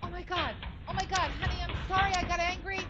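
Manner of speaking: high, frantic apology building to a scream; rising panic, ending very high; panicked calling. Acted. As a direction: call desperately after a child, voice climbing. Mother panics, calling after son.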